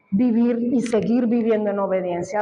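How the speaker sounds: ordinary talk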